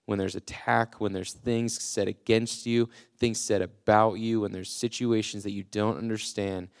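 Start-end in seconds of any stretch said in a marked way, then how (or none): none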